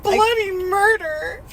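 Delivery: high, slow mock scream of bloody murder; hammed-up horror scream. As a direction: wail it out like a hammed-up horror victim. Mock scream after story line.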